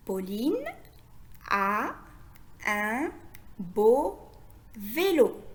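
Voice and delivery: word by word with long pauses; dictation-like, each word separated and held; lecture mot à mot. Shown as a demonstration. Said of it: "Je répète". (lecture lente, un mot à la fois)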